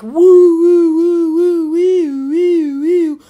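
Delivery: loud, high wailing siren; vocal siren wail; imitated police siren. Imitates arriving police cars' sirens.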